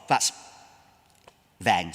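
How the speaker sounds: ordinary delivery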